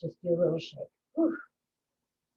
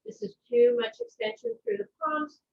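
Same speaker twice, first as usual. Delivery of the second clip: a mock complaining body voice, much higher; 12 semitones higher than usual. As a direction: lightly play your body protesting, pitched high. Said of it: "And if your body says".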